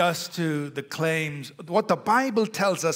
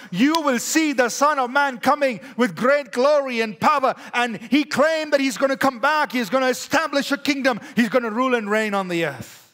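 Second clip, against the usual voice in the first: loud, higher, driving sermon voice; full-force preaching; 6 semitones higher, 5 dB louder than usual. Acted. Preacher proclaiming the resurrection to the congregation, fervent. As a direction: preach it with fire.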